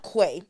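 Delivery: "Quay" said as kway, with an ay ending; rejected pronunciation. Shown as a demonstration. Puzzling over a guest's name, "Quay" said as kway. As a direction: say "Quay" to rhyme with "way". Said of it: "how to say this beginning part".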